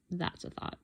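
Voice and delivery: soft unvoiced echo; musing whisper. Real, not acted. Musing on making a card, in a whisper. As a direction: whisper the idea to yourself. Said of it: "Maybe I can make him a card".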